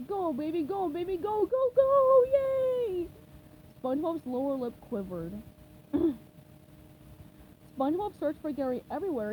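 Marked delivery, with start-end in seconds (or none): 0.1-3.1 building louder and higher cheers; cheering. A narrator's reading. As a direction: cheer the machine on. Cheering the machine.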